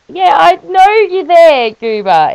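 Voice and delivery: high, loud, drawn-out teasing; teasing the pet. Real, not acted. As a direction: playfully call out the cat. Teasing her pet Goober.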